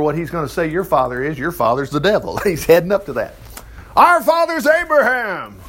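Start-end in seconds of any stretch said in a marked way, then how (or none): 4.0-5.6 high, drawn-out protest; indignant retort. Acted. Pharisees answer Jesus about their father, indignant. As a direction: protest it slowly, pitched well up.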